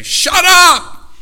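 high, full-voiced shout easing at the end; furious preacher's yell; exasperated scream. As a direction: scream down at a hypocritical church. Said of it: "God doesn't just want to scream".

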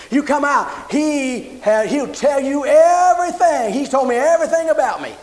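high, loud, jubilant announcing; excited shout. Retold or imitated. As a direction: shout the news to the whole town.

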